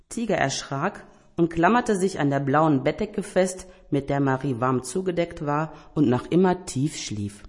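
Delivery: ordinary delivery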